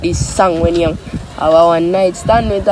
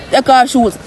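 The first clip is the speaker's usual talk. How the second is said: ordinary delivery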